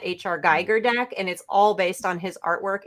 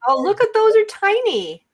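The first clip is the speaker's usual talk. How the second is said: gasp, then high, louder delight; 14 semitones higher, 6 dB louder than usual. Real, not acted. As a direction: gasp at something adorable. Friend gasps seeing cards.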